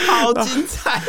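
high laughing exclaim. Real, not acted. Listener laughing at the story, high and laughing. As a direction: burst out laughing as you say it. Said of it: "laughing reaction".

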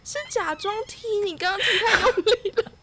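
laughing as she quotes the man she kicked; laughing retelling. Retold or imitated. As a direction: quote him while cracking up. Retelling him after the kick, laughing.

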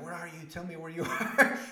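high, loud, laughing through a panicked mom; half-laughing imitation of a scared mother; frantic mom. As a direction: mom begging her son to say where he is. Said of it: "And she's like".

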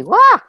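sudden high, loud cry of feeling; overwhelmed yelp breaking out; real rush of emotion, high wail; emotional wail. Real, not acted. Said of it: "ooh, it makes me".

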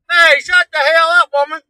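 high, loud hollered order; bossy shout. Acted. Son acting out telling mama off, bossy shout. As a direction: holler it like a cranky husband.

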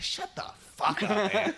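mock exasperated. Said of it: "I'm like".

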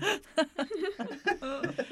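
high-pitched maniacal cackle; maniacal laugh. Acted. Bully Lynette after getting two demerits, maniacal laughter. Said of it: "Lynette's just laughing, like, maniacally".

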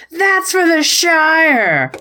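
high, drawn-out heroic cry; hammed-up rallying cry, stretched out high; mock battle cry. Imagined hobbit wrestler flying at his opponent, mock battle cry.

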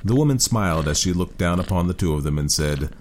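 usual speaking voice

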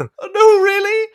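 high, loud burst of real surprise; shocked disbelief. Real, not acted. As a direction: gasp it out, genuinely stunned. Shocked reaction to mom's comment.

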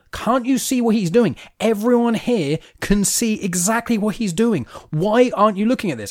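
high, slow, impassioned mock yell at moderate volume; retold yelling. Retelling a son confronting his mum, mock yelling. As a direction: imitate an upset outburst without fully yelling. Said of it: "and he's just yelling".

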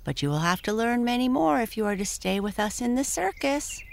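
high, fast pony voice; talking pony. Acted. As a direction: rattle it off like a lively pony. Tiny Tim voice.

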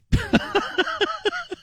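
short high mocking laugh; scornful laugh. Real, not acted. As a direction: laugh off the absurd idea. Host laughs at tree equity.

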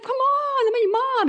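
upset mom pleading. Retold or imitated. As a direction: plead with your teenage son, way up high. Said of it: "I was so upset".

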